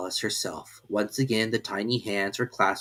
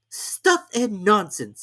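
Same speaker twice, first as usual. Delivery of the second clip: loudly; 11 semitones higher, 5 dB louder, much slower than usual. A narrator's reading. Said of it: "said Alice loudly".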